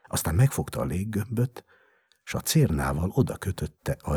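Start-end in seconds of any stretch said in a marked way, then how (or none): none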